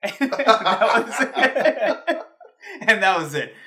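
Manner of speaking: words through real laughter; cracking up while telling it; laughing. Real, not acted. Laughing at his own story.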